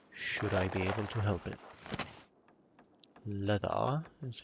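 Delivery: a dry, mock-formal British accent; dry British accent, lightly put on. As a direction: play the stiff British gentleman, deadpan. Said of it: "my very dry British accent".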